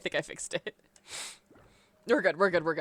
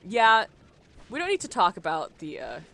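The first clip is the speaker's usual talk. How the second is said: normal talking voice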